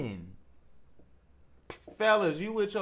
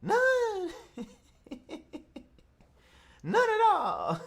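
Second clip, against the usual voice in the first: high incredulous squeal; 12 semitones higher, slower than usual. Real, not acted. Host disbelieving the guest, high incredulous squeal.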